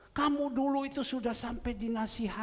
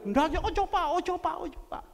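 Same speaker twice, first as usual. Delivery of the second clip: pleading wife; 8 semitones higher, 7 dB louder, much faster than usual. Retold or imitated. Wife begging pastor not to.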